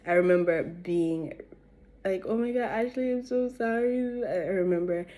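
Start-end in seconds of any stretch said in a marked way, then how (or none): 2.3-4.2 higher-pitched imitation of drunken apologizing; tearful apology. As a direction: plead high, mock-tearful, without real crying. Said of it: "I remember crying".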